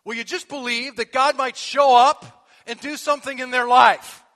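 loud, rising preaching appeal; preaching at full force. Acted. Pastor urging the congregation, loud and building. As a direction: call them out to invite.

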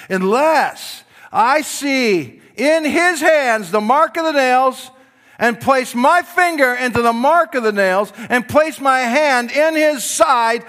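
stubborn doubter laying down his terms; defiant Thomas. Retold or imitated.

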